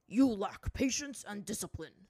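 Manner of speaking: loud, firm rebuke; stern master. A narrator's reading. Zhang Zhang rebuking Azula, stern. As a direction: scold with a master's authority.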